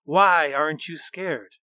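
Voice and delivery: ordinary delivery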